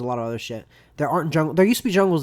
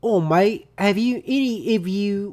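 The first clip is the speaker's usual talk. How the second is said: loud, higher-pitched attempted Australian accent; 6 semitones higher, 6 dB louder, slower than usual. Quoting an Australian's message in an attempted Australian accent. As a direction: imitate an Australian, slowing as it falters. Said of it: "Do that Australian accent real quick".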